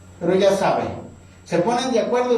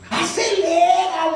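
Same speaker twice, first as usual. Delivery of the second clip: pleading; 11 semitones higher, much slower than usual. Retold or imitated. Urging taxi.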